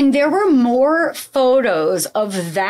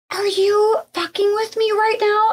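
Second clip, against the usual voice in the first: retold shriek; 10 semitones higher than usual. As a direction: relive the shock in a high, mock shriek. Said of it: "I shrieked".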